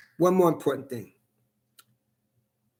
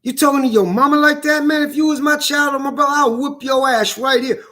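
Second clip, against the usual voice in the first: retold scolding; 8 semitones higher than usual. Scolding a boy who cursed his mom, angry and higher. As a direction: tell the boy off hot and quick. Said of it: "I said".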